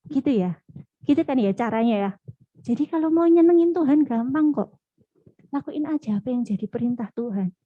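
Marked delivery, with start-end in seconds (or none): none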